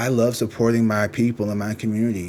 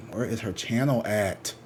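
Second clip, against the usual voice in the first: ordinary talk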